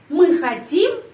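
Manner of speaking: emphatic questioning lift on хотим; question intonation. Shown as a demonstration. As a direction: ask by pushing the end of хотим up. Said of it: "saya menyusun kalimat tanya".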